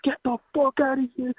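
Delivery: imitating a drunk local's high yell. Caller imitating a drunk local yelling at them, a mock yell. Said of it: "he's just yelling at us".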